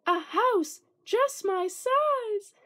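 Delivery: high, slow, delighted little mouse. A narrator's reading. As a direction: breathe it out in wonder, a tiny mouse. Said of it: "Mortimer sighed with delight".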